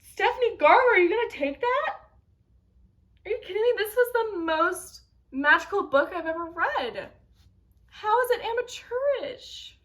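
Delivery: high-pitched, outraged disbelief; indignant protest. Real, not acted. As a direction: protest like a fan leaping to an author's defence. Outraged defence of favourite book.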